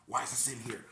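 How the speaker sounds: loud, sharp demand from the coworker; loud retold demand. Coworker demanding why the pizza sits there, loud and sharp. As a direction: bark it at the pizza like an angry coworker. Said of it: "Let me redeem myself".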